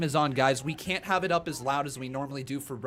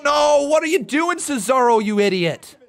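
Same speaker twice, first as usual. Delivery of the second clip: loud, frustrated shout at the screen; angry yell; 11 semitones higher, 8 dB louder than usual. Real, not acted. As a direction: scold him loudly in disbelief. Yelling at a wrestler on screen.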